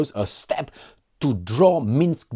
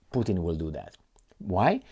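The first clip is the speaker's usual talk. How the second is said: ordinary delivery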